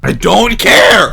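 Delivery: loud, slow, fed-up yell; slow, loud, drawn-out shout of frustration; exasperated outburst. Real, not acted.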